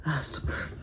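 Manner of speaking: breathy whisper after a burp. Real, not acted. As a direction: whisper it, gassy. Burp rising, unvoiced.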